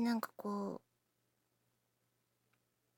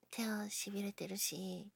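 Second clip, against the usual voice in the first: usual speaking voice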